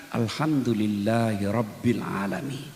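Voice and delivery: low, slow and drawn-out praise; lingering low thanksgiving; slow, grateful recitation. Shown as a demonstration. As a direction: savour every word with a full heart. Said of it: "Coba ucapkan dengan lapang dada".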